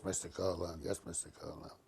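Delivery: soft, meek yes-sir reply; quiet humble yes to the manager; meek deference. Retold or imitated.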